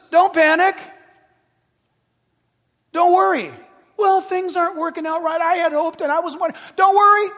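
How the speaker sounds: loud, high reassurance; emphatic shout. Real, not acted. Pastor urging the church, loud and high. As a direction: call it out firmly.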